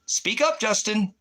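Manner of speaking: high, sharp call to speak up; demanding yell. Real, not acted. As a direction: stream host barking at a silent viewer, demanding yell. Yelling at viewer on livestream.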